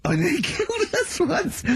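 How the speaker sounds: high-pitched, laughing confession; giggling at your own near miss. Real, not acted. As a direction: tell it through real laughter. Cracks up recalling the buggy.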